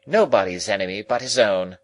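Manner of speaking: loud, brusque verdict; brusque, forceful pronouncement; abrupt lady. A narrator's reading. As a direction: pass judgement abruptly and loudly. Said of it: "with an abruptness of manner".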